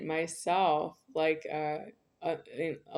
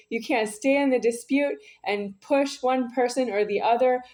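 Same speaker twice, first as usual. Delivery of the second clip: loud, higher furious yelling at someone; angry shouting; 5 semitones higher, 7 dB louder than usual. Real, not acted. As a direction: shout them down, raging.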